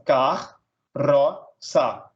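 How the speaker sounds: "car", "ro", "sa" as three separate beats; split into syllables. Shown as a demonstration. Teacher dividing carroça for students, "car, ro, sa" syllable by syllable. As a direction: pause after each of "car", "ro", "sa". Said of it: "separar um em cada sílaba".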